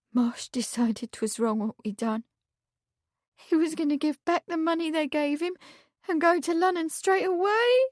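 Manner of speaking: put-on a tearful young maid. A narrator's reading. Meg's voice.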